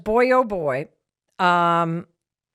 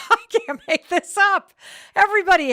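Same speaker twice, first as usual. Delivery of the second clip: laughing; 12 semitones higher, 4 dB louder than usual. Real, not acted. Laughing at dog barking on cue.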